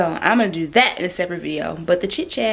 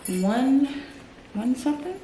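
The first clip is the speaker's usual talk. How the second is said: normal talking voice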